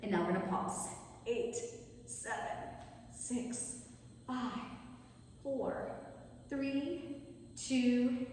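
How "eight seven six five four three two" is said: soft counting. Real, not acted. Pulse count fading softer.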